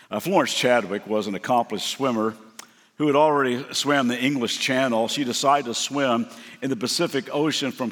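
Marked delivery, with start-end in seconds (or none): none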